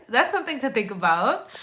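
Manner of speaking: usual speaking voice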